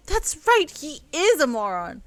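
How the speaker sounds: high, loud That's right; emphatic agreement. Acted. James backing Elle up against her ex, emphatic. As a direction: cheer her on.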